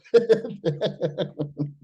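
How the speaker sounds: high, breathy burst of laughter at himself; amused laugh. Real, not acted. Laughing at himself over being so irritable, high amused laugh. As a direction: laugh at how irritable you were.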